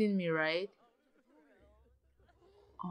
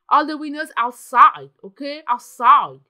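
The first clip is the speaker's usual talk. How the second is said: loud order; 12 dB louder than usual. Real, not acted. Barking orders at Sims.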